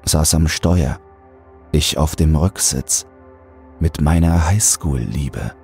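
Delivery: ordinary delivery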